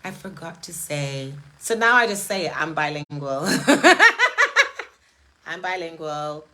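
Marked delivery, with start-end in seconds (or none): 0.0-1.3 soft, offhand sheepish admission; understated, softer aside; sheepish retort. Retold or imitated. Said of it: "And I was like". 3.5-4.8 high, loud burst of laughter; loud cackling laugh; big laugh. Real, not acted. Laughs at own bilingual joke.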